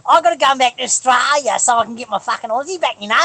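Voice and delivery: high, broad Australian bogan ranting; hammed-up Aussie accent. Proving he's Aussie enough, exaggerated broad Australian. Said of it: "I'm being Aussie".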